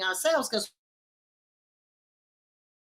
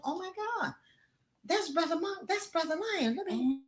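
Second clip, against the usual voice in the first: high, softer gasp of a monkey who hears his brother; worried little monkey, pitched up; alarmed monkey; 9 semitones higher, 5 dB quieter than usual. Acted. Monkey hears lion hollering.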